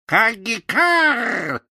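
slow, high mocking caw; cackling crow caw. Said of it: "захохотала она". (хохочущее карканье вороны)

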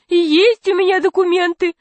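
high-pitched fat man insisting; thin high voice. A narrator's reading. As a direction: protest in a piping voice. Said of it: "сказал толстяк тонким голосом".

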